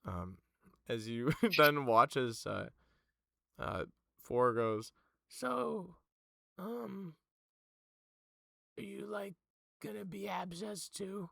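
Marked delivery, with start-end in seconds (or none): 5.3-11.3 put-on much higher, curious young voice; Four's voice. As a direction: ask it up high and innocent. GM voices Four.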